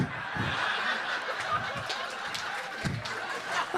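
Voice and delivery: breathless, high giggling laugh; real laugh. Real, not acted. Comedian cracking up at his own joke, breathless giggle. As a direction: lose it and laugh silently. Cracks himself up mid-story.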